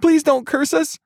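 very high, loud pleading shout; desperate shout. A narrator's reading. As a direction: beg in terror at the top of your voice. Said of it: "the other friend shouted".

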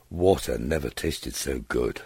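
usual speaking voice

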